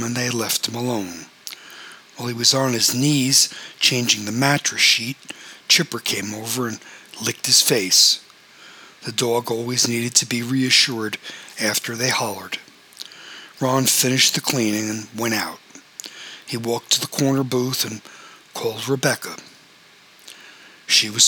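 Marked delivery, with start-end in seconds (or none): none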